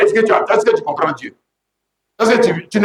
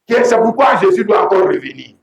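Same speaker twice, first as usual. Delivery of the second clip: normal talking voice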